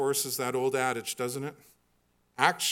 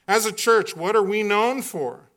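normal talking voice

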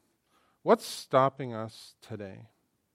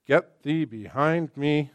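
slow stern rebuke; 5 dB louder, much slower than usual. Acted. Jesus rebuking Peter.

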